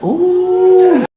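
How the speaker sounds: high, far squeal of delight; delighted ooh. Retold or imitated. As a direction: squeal at the sight of him. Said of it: "You were like".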